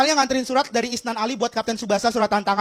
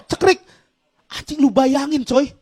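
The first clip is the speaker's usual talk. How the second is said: usual speaking voice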